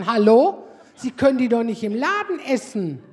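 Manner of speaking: loud indignant shop assistant; mock scolding shout. Shop staff scolding a customer eating chocolates, indignant shout. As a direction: snap at the customer for eating stock.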